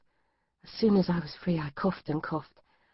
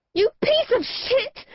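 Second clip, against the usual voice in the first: much higher, louder, slow furious shout; mad high-pitched shout; 6 dB louder, slower than usual. Acted. Captive girl screaming at her captor, mad and high-pitched. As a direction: scream it at him, unhinged. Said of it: "I shouted in a mad, high-pitched voice".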